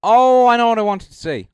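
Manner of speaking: sudden realization. Real, not acted. Suddenly remembers his point.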